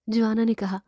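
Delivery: usual speaking voice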